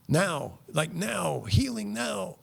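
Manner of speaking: climbing mock-excited chant of instant healing; mock hype. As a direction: squeak it up like a parody of a hyped healer.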